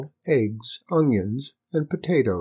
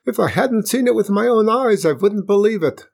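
amazed; 11 semitones higher, 5 dB louder than usual. A narrator's reading. Matka Fool amazed at latkes.

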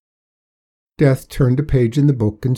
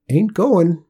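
put-on higher, firm old-timer refusal; stubborn old man; 8 semitones higher, 5 dB louder than usual. Old Hark voiced by narrator.

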